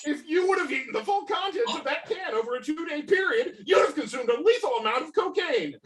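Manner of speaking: fast, high rattled-off reveal; rapid explanation. Acted. Ted explains the cocaine chips to Dave, rapid rant. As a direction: rush through the reveal.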